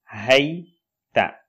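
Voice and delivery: hayta with a breathy h and a falling ending; model word. Shown as a demonstration. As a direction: say hayta, letting it fall at the end. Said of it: "Le premier".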